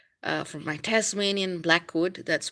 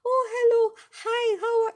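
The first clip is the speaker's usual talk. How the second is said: put-on very high, cooing baby-talk hello; cooing at a baby mid-session; baby talk greeting; 4 dB louder, faster than usual. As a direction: gush at the baby who interrupted. And then be like.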